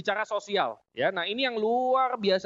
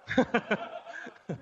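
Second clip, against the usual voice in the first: breathy laugh. Real, not acted. Laughing at own marriage joke.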